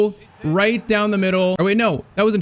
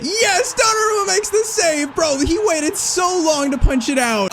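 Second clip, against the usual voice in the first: much higher, real joy; ecstatic cheer; 11 semitones higher than usual. Real, not acted. Gamer celebrating the winning save, ecstatic cheer. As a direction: erupt with relief at the save.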